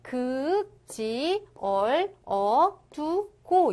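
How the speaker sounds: 남, 극, 지, 얼, 어, 두, 고 with gaps; syllable-by-syllable reading. Shown as a demonstration. Teacher demonstrates the reading, 남, 극, 지, 얼, 어, 두, 고 separated. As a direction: give each syllable its own beat, 남, 극, 지, 얼, 어, 두, 고. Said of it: "이런 식으로 읽으시면 되겠습니다".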